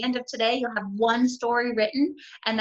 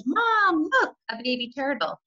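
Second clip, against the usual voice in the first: put-on high excited child's call; child's excited yell; 7 semitones higher than usual. Teacher voicing her daughter calling to mom, excited. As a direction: call out like an excited little girl. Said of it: "my daughter Kyle yelled".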